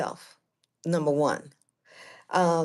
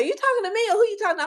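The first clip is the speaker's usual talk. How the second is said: fast, higher-pitched husband quote; rapid mock questioning; husband's questioning; 10 semitones higher, much faster than usual. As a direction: imitate a puzzled husband, quick and high. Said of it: "my husband said".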